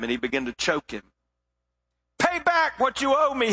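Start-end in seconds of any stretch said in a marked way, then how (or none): 2.2-3.5 higher, pressing demand. A narrator's reading. Choking servant demands his money back, sharply raised. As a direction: bark the demand at him. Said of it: "he demanded".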